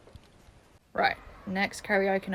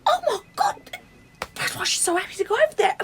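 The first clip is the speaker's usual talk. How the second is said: high, loud gasp of discovery; squealing with sudden excitement; excited realisation; 13 semitones higher, 7 dB louder than usual. Acted. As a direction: burst out as it dawns on you. Excited realisation about a crush.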